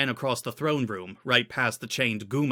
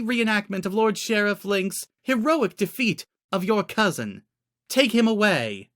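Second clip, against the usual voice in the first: high, louder queen voice, measured; queen's sentence; 9 semitones higher, 3 dB louder than usual. A narrator's reading. Queen sentencing the prisoner at the trial, high regal voice. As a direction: hand down the sentence coolly.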